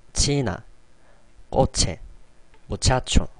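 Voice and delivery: 치나, 꼬체, 무차초 with a clear Korean ㅊ; crisp ㅊ on each word, one by one; Spanish CH demo. Shown as a demonstration. Teacher showing learners the CH sound, clear demo. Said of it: "발음 한번 해볼까요?".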